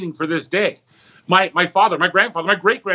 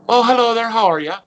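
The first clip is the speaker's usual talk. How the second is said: put-on sweet old nun; 7 semitones higher, 3 dB louder than usual. Old nun greets visitor.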